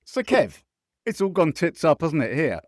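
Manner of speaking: put-on loud Scottish-accented impersonation of a co-host; mock Scottish pundit sounding off; Barry. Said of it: "I'll have to be him".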